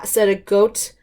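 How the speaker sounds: plain reading voice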